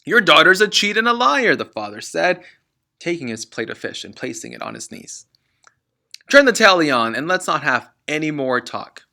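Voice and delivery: father complaining to mother; angry father. Acted. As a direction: growl it out furiously.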